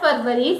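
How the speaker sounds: FEBRUARY as FUR-vuh-ree; wrong pronunciation. Shown as a demonstration. Teacher rejecting the wrong pronunciation. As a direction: say FEBRUARY as Hindi "farvari". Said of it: "It is not February".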